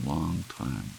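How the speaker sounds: ordinary delivery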